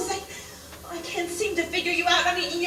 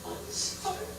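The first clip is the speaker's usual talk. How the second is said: whispered apology; 4 semitones higher, 15 dB quieter than usual. Acted. Apologizing after confession.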